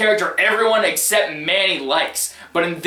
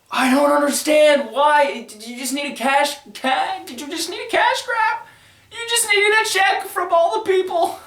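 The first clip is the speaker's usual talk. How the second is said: hammed-up whining meltdown; exasperated disbelief; 8 semitones higher than usual. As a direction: voice climbing from high to squeaky falsetto. Despairing plea to actor over movie.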